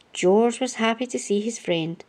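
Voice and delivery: plain reading voice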